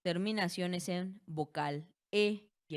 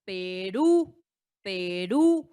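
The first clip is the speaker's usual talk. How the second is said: "Perú" with a long, loud final "rú"; stress on last syllable; 10 dB louder, much slower than usual. Shown as a demonstration. Teacher showing where the tonic syllable falls, final stress on "Perú". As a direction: punch and stretch the "rú" each time in "Perú". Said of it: "recae en la última".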